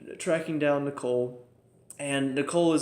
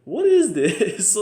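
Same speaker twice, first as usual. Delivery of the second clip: slow, higher, louder what-is-this; baffled disbelief; 6 semitones higher, 8 dB louder, much slower than usual. Real, not acted. Reacting to the villain's squeaky voice, stunned and incredulous. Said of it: "and I'm just like".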